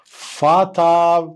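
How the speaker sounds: فاتر drawn out slowly as fatar; slow model pronunciation. Shown as a demonstration. Teacher teaching the child the German word for dad. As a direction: stretch فاتر sound by sound. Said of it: "قول معايا، فاتر".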